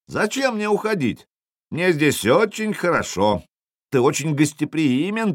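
high-pitched taunting thanks; lifted, teasing call; mocking politeness. A narrator's reading. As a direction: needle the enemy with sham gratitude. Taunting the jarl with mock gratitude.

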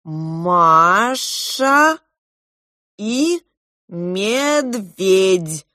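slow, stretched-out sounding out; syllable-by-syllable reading. A narrator's reading. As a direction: read it haltingly with long pauses. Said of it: "прочитал по складам". (читая по складам)

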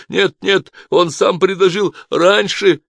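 high, loud denial; panicked protest. A narrator's reading. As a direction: protest desperately against the charge. Cornered guard protests in panic.

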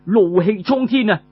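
ordinary delivery, nothing marked

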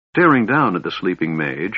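usual speaking voice

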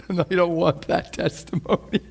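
words through laughter; laughing. Real, not acted. Preacher after picturing himself as doubter, laughing. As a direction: say it while still laughing. Amused after his own enactment.

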